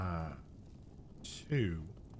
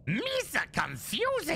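silly cartoon voice; 12 semitones higher, slower than usual. Mock baby talk of own words.

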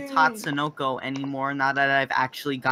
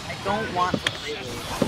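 ordinary talk

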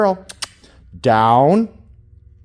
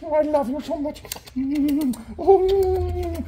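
cooing affection; 13 semitones higher, slower than usual. Real, not acted. Cooing love to puppy.

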